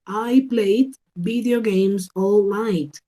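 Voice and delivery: slow, careful example read; slow model sentence. Shown as a demonstration. Teacher reading an example sentence slowly for students. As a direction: spell it out slowly for the class. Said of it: "yo digo played".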